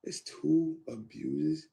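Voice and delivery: drawn out, rising, stunned question; slow disbelief. Retold or imitated. Repeating the news to himself, slow stunned question. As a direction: let it sink in slowly. Shocked at hearing of abuses.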